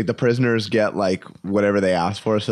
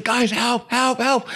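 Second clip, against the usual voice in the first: high, pleading cries; mock panic; 13 semitones higher, slower than usual. Buddy chased by a baboon, high mock panic. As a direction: beg for help while fleeing. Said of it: "He's like".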